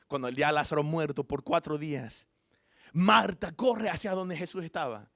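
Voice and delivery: normal talking voice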